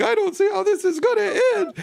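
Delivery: very high, falsetto mock despair; imitating a despairing cohost. Host imitating cohost's on-air meltdown, high falsetto. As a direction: squeal it like a hammed-up meltdown. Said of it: "you were like".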